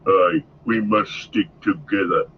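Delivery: low orc growl; lightly growled, low and firm; a hint of a gruff orc chief agreeing grimly; Ugluk growling. A narrator's reading. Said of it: "growled Ugluk".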